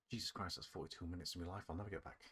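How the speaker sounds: normal talking voice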